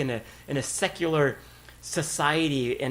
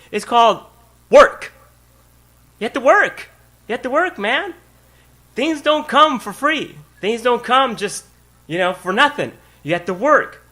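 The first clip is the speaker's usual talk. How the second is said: loud, high preaching build on work; full-force preaching; 5 semitones higher, 6 dB louder than usual. Acted. Pastor preaching to the congregation about work, loud and high.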